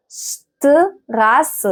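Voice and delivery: شتراس slowly broken up, "sh-t-rasse". Shown as a demonstration. Teacher breaking down the word for learners, slow "sh" in شتراس. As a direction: sound out شتراس in pieces from a "sh". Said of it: "بتتنطق شين".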